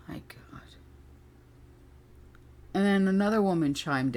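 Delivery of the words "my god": breathy hushed my God; stunned whisper; whispered disbelief. Retold or imitated. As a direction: mutter it under your breath in shock. Retold reaction of disbelief.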